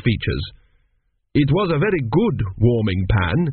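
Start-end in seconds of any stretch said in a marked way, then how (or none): none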